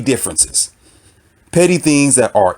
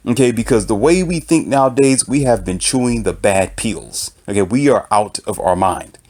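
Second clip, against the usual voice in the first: ordinary delivery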